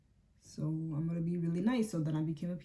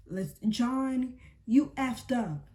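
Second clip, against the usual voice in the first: raised, higher-pitched call-out; mock angry yell; 6 semitones higher, 6 dB louder, much slower than usual. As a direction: imitate her yelling at him on the call. Retold angry confrontation on call.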